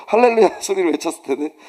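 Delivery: ordinary talk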